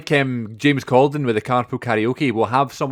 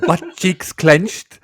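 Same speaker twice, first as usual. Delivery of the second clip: slow, higher and louder mimicry; acted film quote; 6 semitones higher, 5 dB louder, much slower than usual. Friend finishing the other's film quote, slow raised mimicry. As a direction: draw each word out like a comic punchline. Quoting the same comic film scene.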